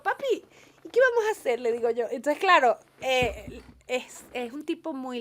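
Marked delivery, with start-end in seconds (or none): 0.0-1.6 put-on louder and higher, teasing Maracucho accent. Teasing Carlos by talking Maracucho, playful Maracucho accent. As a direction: joke with him in a thick Maracucho accent. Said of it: "le empiezo a hablar maracucho".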